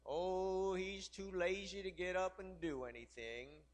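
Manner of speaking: drawn-out, sluggish drawl; too lazy to move, stretching the vowels; lightly acted lazy porch-dog voice; lazy hound dog. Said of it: "big dog just kinda laying".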